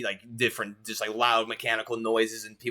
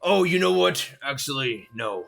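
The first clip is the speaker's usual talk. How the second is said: lightly voiced orc clerk, friendly and chatty; a hint of a busy clerk checking a list.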